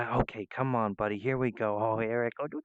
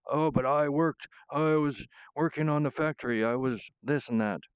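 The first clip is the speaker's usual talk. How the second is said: a hint of a whiny old man; mocking old man; 5 semitones higher than usual. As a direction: sneer at a grumbling old timer. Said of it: "old man".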